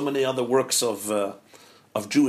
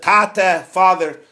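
raised, pleading cry to father; pleading call; 3 semitones higher, 6 dB louder, much slower than usual. Acted. Son pleading with his father to take the coat, raised voice. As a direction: call out to him, louder.

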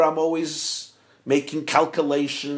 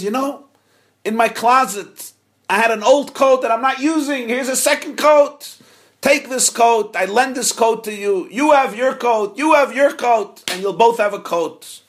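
higher, louder kindly rabbi voice; generous rabbi; 7 semitones higher, 5 dB louder than usual. Acted. Rabbi giving his spare coat to father and son, raised. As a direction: hand it over with big generosity.

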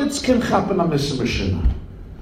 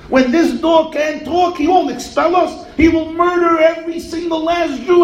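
mock screaming crowd; 10 semitones higher than usual. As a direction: yell at him like a panicked mob, much higher. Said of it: "They start screaming at him".

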